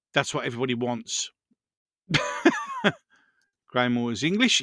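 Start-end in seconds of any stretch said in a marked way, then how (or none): 2.1-2.9 sudden high squeal of a laugh; real giggle. Real, not acted. Laughing at chat comment.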